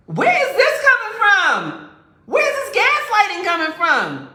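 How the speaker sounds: high, loud, stretched outrage; outraged yell. Retold or imitated. Boyfriend yells at her, outraged by the accusation. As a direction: shriek the question in disbelief.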